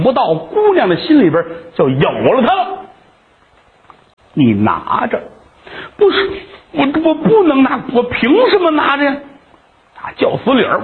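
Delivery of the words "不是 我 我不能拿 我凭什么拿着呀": high, stammered, almost tearful; young man flustered near tears; pleading refusal. Acted. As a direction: plead to be let off.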